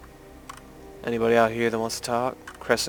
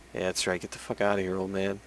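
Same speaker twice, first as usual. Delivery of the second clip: muttered under the breath, much softer; quiet mutter; 8 dB quieter than usual. Real, not acted. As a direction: mutter a rude send-off. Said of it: "mutters dismissal".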